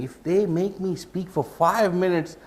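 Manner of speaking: high-pitched, mock-flustered novice speaker; mock panic, rising into a rapid high protest; worried novice speaker. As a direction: act out a speaker stuck for words, pitch climbing high. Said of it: "So you're wondering".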